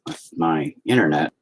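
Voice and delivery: ordinary talk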